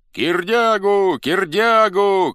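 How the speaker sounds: high, very loud, long-drawn cries; crowd shout. A narrator's reading. Crowd takes up the new name, shouting. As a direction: chant the name at full voice. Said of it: "кричала толпа".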